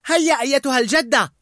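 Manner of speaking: high, loud teasing call; playful taunt. Acted. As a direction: egg her on excitedly. Riley teases mother while skating.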